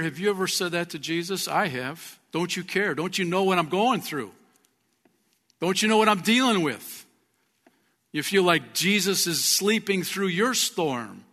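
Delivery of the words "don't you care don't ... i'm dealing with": loud, high-pitched challenging questions; pleading protest. Acted. Believer going through a storm questions Jesus, loud pleading protest. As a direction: demand an answer, building in force.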